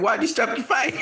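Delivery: high, puzzled retold question; acting out Wilder asking the coach why, confused. Wilder asking his coach, imitated confused high question.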